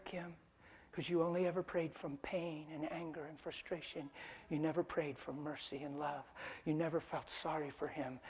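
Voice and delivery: God gently telling Kim why her prayer went unanswered, soft and tender; gentle voice of God. Acted. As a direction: speak softly, like comforting her. God speaks gently to wife.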